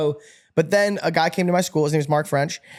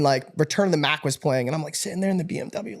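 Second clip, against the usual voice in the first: usual speaking voice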